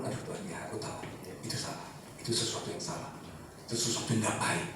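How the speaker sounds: soft near-whisper admitting fault; whispered confession. Retold or imitated. Believer confessing to God, almost whispered. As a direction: breathe the words out privately.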